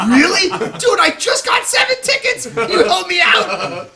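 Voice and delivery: high, loud laughing shout; excited outburst. Retold or imitated. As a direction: yell it out, delighted and laughing. Excited about his tickets.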